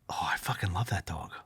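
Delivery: whispered secret confession; inner whisper. Retold or imitated. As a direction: breathe it so the wife can't hear. Secret thought while arguing.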